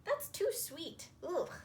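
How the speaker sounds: too sweet. Acted. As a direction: pull a face at the sweetness. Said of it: "took a big spoonful and said".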